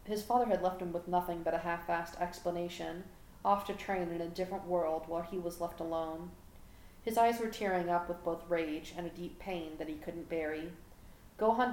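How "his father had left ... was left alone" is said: plain reading voice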